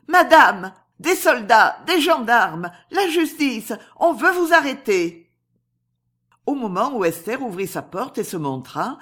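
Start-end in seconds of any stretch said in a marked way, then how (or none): 0.1-5.3 high, loud warning to her mistress; alarmed cry. A narrator's reading. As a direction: cry out each threat in alarm. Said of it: "criait-elle". (cri d'alarme aigu)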